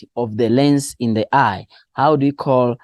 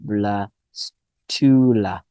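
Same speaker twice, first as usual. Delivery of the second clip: "blastula" drawn out slowly in separate syllables; 2 semitones lower, much slower than usual. Shown as a demonstration. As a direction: sound out "blastula" slowly so students remember it. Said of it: "Make sure that you know".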